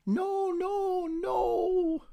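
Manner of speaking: high, crying no; wailing refusal. A narrator's reading. Bride refusing Waldo at the altar, high crying wail. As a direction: wail the refusal, near tears.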